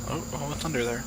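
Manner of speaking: ordinary delivery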